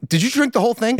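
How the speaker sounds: high-pitched mock disbelief; retold incredulous question. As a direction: quote a friend's startled question, voice jumping up high. Retells a friend's startled question.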